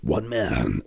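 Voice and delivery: deep, drawn-out character voice; voice-acting character voice. Shown as a demonstration. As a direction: put on a low, slow voice-over tone. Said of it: "Either just my normal voice, or sometimes".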